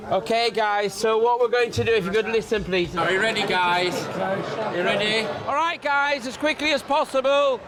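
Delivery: rally the group loudly; calling out to group. Real, not acted. Tour guide calling the group.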